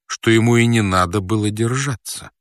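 usual speaking voice

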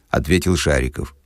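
ordinary delivery, nothing marked

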